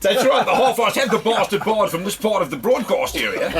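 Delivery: high, laughing mock announcer; South African accent. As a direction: ham up a South African promo. Comic radio ad impression.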